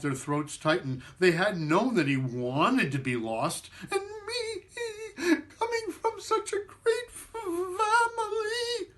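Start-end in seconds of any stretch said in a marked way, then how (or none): 3.9-8.9 very high, breaking on family, slowed; high crying squeak. Acted. Matzo man lamenting his family, high tearful squeak. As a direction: sob through the words in a tiny voice.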